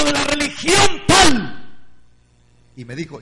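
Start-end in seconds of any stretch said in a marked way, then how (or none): 0.0-1.8 high, loud, drawn-out words; slow shout. Retold or imitated. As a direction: shout it slowly, stretched. Pastor shouts name of sect. (grito lento y alargado)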